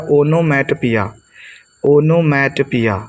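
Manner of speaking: ओनोमैटोपिया slowly, as "ono-mat-piya"; correct pronunciation. Shown as a demonstration. Teacher assembling the word for students, ओनोमैटोपिया slow, ending "piya". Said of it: "तो क्या हो जाएगा?".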